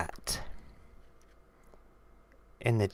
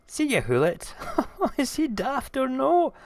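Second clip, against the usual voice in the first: high, loud mocking mouse voice; squeaky-high gloating; gleeful mouse; 4 dB louder than usual. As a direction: crow with glee, laughing at the fool who left. Mouse mocks the fooled owl.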